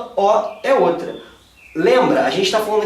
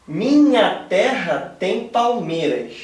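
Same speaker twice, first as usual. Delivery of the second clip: slow syllable counting; much slower than usual. Shown as a demonstration. Teacher scanning the verse for his students, slow and measured. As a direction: read very slowly, syllable by syllable, for scansion. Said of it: "vamos pra primeira".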